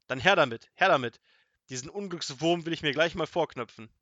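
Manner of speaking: loud, insistent wizard's order; forceful, impatient storybook command; angry demand. A narrator's reading. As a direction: demand it loudly, fired up. Wizard demands boot, wants revenge.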